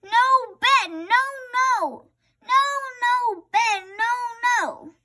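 high, loud chanted refusal; child refusing bed; tantrum. A narrator's reading. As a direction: throw a bedtime tantrum. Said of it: "I rolled and rolled and said".

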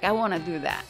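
ordinary delivery

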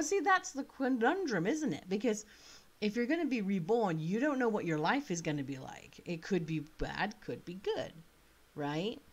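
ordinary talk, nothing marked

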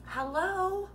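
high, knowing "Hello."; drawn-out sassy hello. Acted. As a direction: sing it out like it's obvious. Sassy greeting aside, higher.

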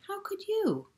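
a small hedgehog's high puzzled squeak; puzzled high question. Acted. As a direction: pitch jumps way up in disbelief.

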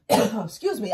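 ordinary talk

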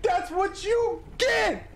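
high, slow sing-song gloat; slow gloating taunt. Real, not acted. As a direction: stretch it out, rubbing it in. Gloating, drawn out.

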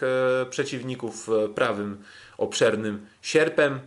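normal talking voice

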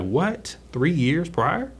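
high, rising, incredulous question; retold shock. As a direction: act out stunned disbelief at the news. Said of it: "I was shocked".

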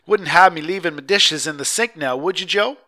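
wife joking back at the official with a grin; grinning. A narrator's reading. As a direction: banter back with a smile.

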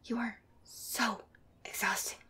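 exasperated whisper, dragged out. Acted. On the phone with a friend while the listener sleeps, whispered. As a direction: breathe it out, fed up.

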